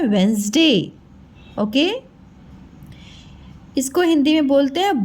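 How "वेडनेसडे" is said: वेडनेसडे as two syllables, WENS-day, lower and falling; standard pronunciation. Shown as a demonstration. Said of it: "इसका pronunciation क्या है?".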